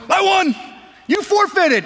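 high, loud indignant yell; shouting kid. Retold or imitated. Big brother shouting at his sibling over the reset game, high and loud. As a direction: yell it at your little brother who forfeited.

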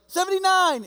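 high, loud shout from another cell; distant prisoner calling out; yelled number. Retold or imitated. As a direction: fling the number across the courtyard.

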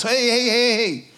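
high, loud urgent cry to Jesus; urgent pleading call. Acted. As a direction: call out desperately from the cross. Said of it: "all he said was".

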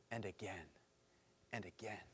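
dropping to a near-hush; fading repetition. Acted.

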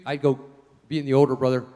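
ordinary talk, nothing marked

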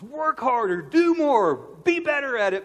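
loud, high, pushy commands; stern pastor dad. Retold or imitated. Dad pushing his son over schoolwork, loud and high. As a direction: bark orders at a lazy kid. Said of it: "my response is".

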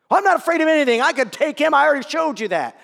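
put-on a kid showing off toughness; boastful child. Son voiced, boasting.

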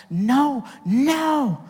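high, climbing emphatic "no". Real, not acted. Emphatic denial in sermon.

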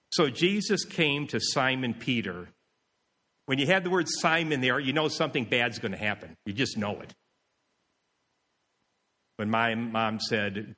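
usual speaking voice

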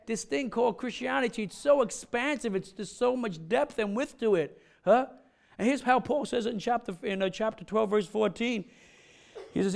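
usual speaking voice

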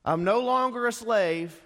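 mock the proud Israelites; preacher mocking Israelites' boast, high and loud; mocking freed slave. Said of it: "let me make fun of them".

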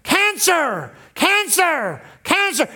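lightly voiced menacing thought; menacing inner voice. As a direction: chant the threat like a voice in her head. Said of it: "cancer screamed".

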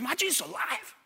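put-on high, piping toddler voice, rising; small child's question. Son asks dad as Jesus walks out, childlike. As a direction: imitate a little boy's wondering question. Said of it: "He looks at me again".

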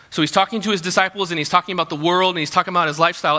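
ordinary talk, nothing marked

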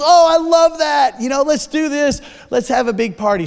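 high, loud delighted shout; thrilled exclamation that settles down; excited cry. Retold or imitated. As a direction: call it out with joy from the back. Wife cheering from the kitchen.

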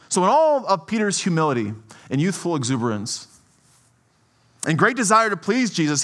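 usual speaking voice

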